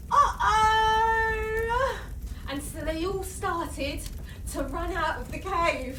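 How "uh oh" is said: loud, high, held-out alarm. Acted. Animals realising the cave is filling with smoke, loud alarmed uh-oh.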